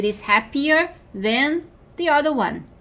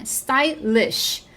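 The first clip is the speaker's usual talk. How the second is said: "Stylish" in two separate beats; "Stylish" said as sty, pause, lish; split into syllables. Shown as a demonstration. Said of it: "ele tem duas sílabas".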